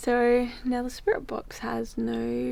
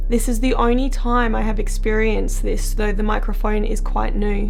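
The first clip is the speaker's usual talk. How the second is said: louder, even narrator read; documentary voiceover; 8 dB louder than usual. A narrator's reading.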